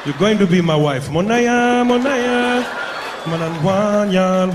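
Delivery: loud African chant, high drawn-out calls; mock African accent. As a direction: call out high and long in a hammed-up African accent. Acted African man claiming a wife.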